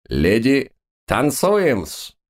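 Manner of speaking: high showy call; booming circus ringmaster. Acted. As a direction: sing it out to the dancers. Magician calls the dancers.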